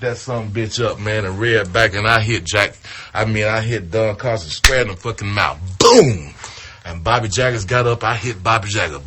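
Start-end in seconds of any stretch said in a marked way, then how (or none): 5.8-6.3 louder impact boom; shouted boom. Retold or imitated. Storyteller voicing the gimmick's hit, loud boom. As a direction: punch the sound out.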